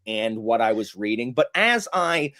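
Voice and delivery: exaggerated imitation of a superior, enlightened reader; dripping contempt. Imitating Joanna slandering star-rating users, with dripping mock contempt. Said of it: "dripping contempt in her voice".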